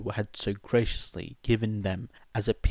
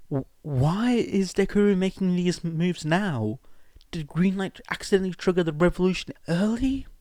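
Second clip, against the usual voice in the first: put-on higher, fast worried young woman; Melissa voice; 9 semitones higher, 4 dB louder than usual. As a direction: ask quickly with rising worry. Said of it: "Melissa added".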